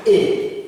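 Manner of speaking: A as a long, held French é; E with accent aigu. Shown as a demonstration. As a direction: hold "A" as the sound of é. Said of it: "it is pronounced as".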